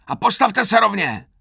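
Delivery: high, loud posture command; slightly milder roar. A narrator's reading. Sergeant straightening up a recruit, slightly milder roar. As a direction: shout it, just short of full fury. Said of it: "zařval už mírněji".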